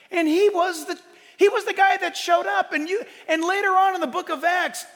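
loud, high, driving sermon voice; preaching at full force. Acted. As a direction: preach it out to the whole room.

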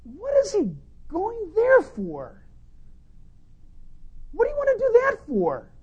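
high, baffled voice of puzzled people; puzzled onlookers. Retold or imitated. People puzzled at a missionary's choice, high and baffled. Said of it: "scratch their head and say".

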